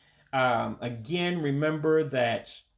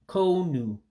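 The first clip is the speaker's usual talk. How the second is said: konu in an Igbo accent, up high, falling at the end; Igbo-accented cone; 6 semitones higher than usual. Shown as a demonstration. Teacher giving cone in an Igbo accent. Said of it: "like saying cone with an accent".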